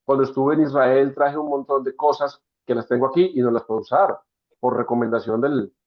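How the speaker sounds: ordinary talk, nothing marked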